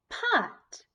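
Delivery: Pot whispered high as the blend; blended word. Shown as a demonstration. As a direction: put the sounds together. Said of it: "we'll put them together".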